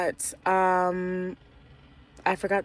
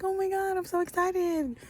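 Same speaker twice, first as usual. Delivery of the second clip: gushing, high-pitched retold delight; sister's excited squeal; 13 semitones higher than usual. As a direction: imitate a thrilled sister, pitched way up. Sister's thrilled reaction, retold.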